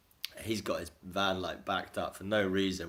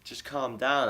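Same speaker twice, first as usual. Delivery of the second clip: higher, louder retold appeal; raised, mildly mock exasperation; exasperated plea; 6 semitones higher, 5 dB louder than usual. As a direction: plead with an irate neighbour to settle down. Retold plea to angry neighbour.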